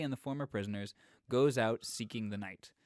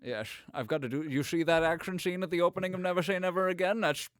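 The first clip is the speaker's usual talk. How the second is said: fast, raised Scottish Connery voice; Sean Connery; 5 semitones higher, 5 dB louder, faster than usual. Acted. Podcaster plays Connery complaining of hard work, loud Connery accent. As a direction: grumble as Connery, slurring every s. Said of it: "you're very good at the Connery".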